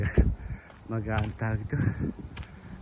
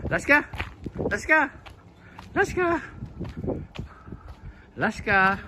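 calling the dog; 13 semitones higher than usual. Real, not acted. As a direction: call out across the yard to the dog.

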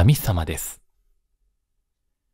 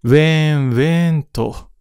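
wailing cry; 6 semitones higher than usual. A narrator's reading. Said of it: "泣き声が聞こえてきます".

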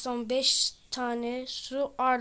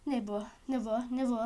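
ordinary talk, nothing marked

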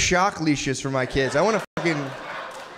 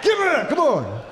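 put-on aggressive kid; 9 semitones higher, slower than usual.

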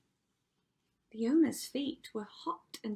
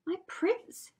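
high, dismayed Cindy voice; dismayed thought; 11 semitones higher, 3 dB louder than usual. A narrator's reading. Cinderella realising what her prince does, dismayed thought. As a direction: think aloud in a high, stricken voice. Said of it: "she thought".

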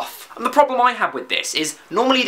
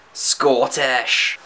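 mock Scottish, lower in pitch; Scottish accent; 5 semitones lower than usual. Shown as a demonstration. Vlogger demonstrating accents by country name, Scottish accent. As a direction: put on a comic Scottish accent. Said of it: "say in that accent is the name".

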